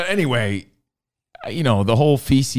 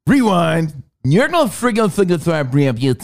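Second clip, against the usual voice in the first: usual speaking voice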